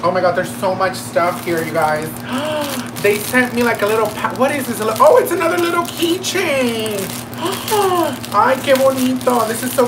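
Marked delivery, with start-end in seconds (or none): none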